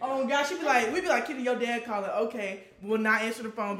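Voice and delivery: loud, animated teasing; real loud teasing. Real, not acted. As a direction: call her out loudly.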